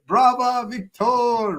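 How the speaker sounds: high, ringing hail of the Republic; mock Roman cheer. Hammed-up legionnaire hailing his Republic to the audience. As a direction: proclaim it like a legionnaire.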